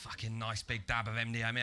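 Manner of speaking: low, understated scorn; deadpan repeat. Real, not acted. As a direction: drop the pitch and repeat it drily.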